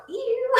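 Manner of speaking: a high, squeaky "Ew"; high, comic ew of disgust. Retold or imitated. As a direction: shriek out a grossed-out ew. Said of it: "this makes me go".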